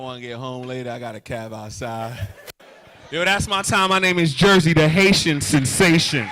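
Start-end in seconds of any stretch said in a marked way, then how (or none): 3.1-6.3 big, announcing the name; loud sign-off. Real, not acted. Comic announcing his name to end the set, loud. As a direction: sell the closer to the crowd. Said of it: "signing off the set".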